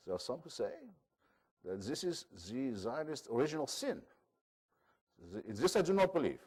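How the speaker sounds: Israeli लहजे की हल्की नकल; a light take on an Israeli professor's accent. Retold or imitated. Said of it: "I'll try his voice".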